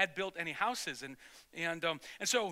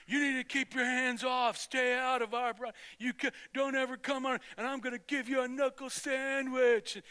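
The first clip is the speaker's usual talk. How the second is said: higher-pitched retold scolding; mock bully threat; 5 semitones higher than usual. Kid blocking two boys' way home, mock bully threat. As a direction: warn them off like a schoolyard bully.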